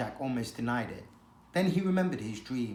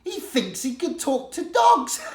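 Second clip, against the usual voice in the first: high, loud scoffing delight; mocking laughter; 13 semitones higher, 7 dB louder than usual. She-cat mocks the idea of talking to dogs, high and loud. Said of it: "laughed out loud".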